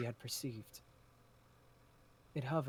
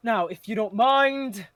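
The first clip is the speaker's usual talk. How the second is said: slow, high and loud haughty dismissal; a rival waving the party aside; smug villain voice; 9 semitones higher, 16 dB louder, slower than usual. Acted. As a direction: draw it out as a condescending exit.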